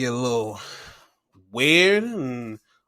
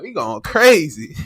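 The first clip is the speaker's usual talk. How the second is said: laughing; 5 semitones higher, 4 dB louder than usual. Retold or imitated. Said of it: "I was like".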